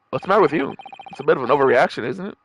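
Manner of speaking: ordinary talk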